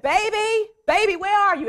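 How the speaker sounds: loud, high call across a store. Retold or imitated. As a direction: call for a lost child among the aisles.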